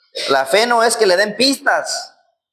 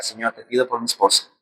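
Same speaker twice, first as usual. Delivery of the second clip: soft, lower kneeling prayer; hushed prayer; 6 semitones lower, 6 dB quieter than usual. Shown as a demonstration. Preacher showing the youth how to pray when tempted, softly. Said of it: "Ahorita me voy a arrodillar".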